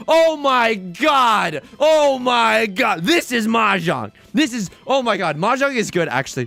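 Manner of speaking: loud, drawn-out pleading cries; frantic excitement. Real, not acted. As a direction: yell it out as the hand wins. Begging for winning tile.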